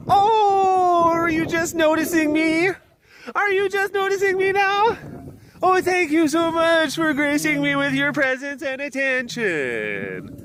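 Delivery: high, far hammed-up fussy voice; mock offended. Keeper teasing the lion for ignoring him, in a high mock-offended voice.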